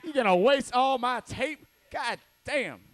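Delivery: high, loud griping over wasted tape; mock angry boyfriend. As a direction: ham up a stingy angry man. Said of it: "It's like".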